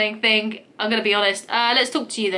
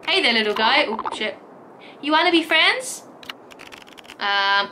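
usual speaking voice

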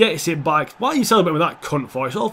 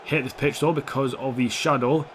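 ordinary delivery

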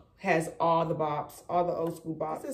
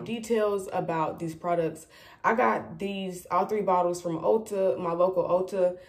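ordinary talk

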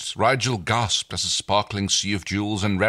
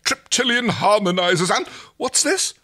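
delighted discovery; 12 semitones higher, 5 dB louder than usual. Acted. Rigel rummaging through treasure.

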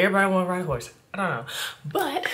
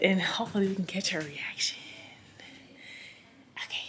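whispering; 8 dB quieter than usual. Real, not acted. Secret surprise, quiet aside.

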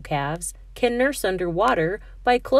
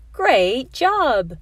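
enthusiastic praise; 6 semitones higher, 5 dB louder than usual. Acted. Teacher praising Panda.

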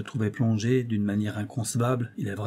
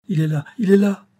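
high, loud ringing cry; 9 semitones higher, 9 dB louder than usual. A narrator's reading. Angélique wakes from her trance with a ringing cry. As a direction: throw it out as a ringing shout. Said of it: "s'écria d'une voix retentissante".

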